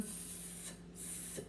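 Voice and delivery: hissed "s, s" with no vowel; isolated s sound. Shown as a demonstration. Teacher sounding out "s" to the class, a soft hiss. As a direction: hold the s sound alone for young readers. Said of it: "I hear that beginning sound".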